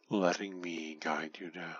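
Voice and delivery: ordinary talk, nothing marked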